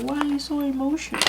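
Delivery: high mock nagging voice; mock scolding of a crybaby. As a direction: tease yourself for crying, high-pitched. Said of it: "It's like".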